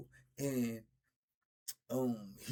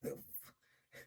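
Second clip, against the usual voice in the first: hushed disgust; 13 dB quieter than usual. Retold or imitated. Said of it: "I be like".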